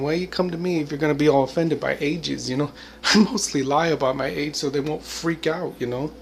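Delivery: usual speaking voice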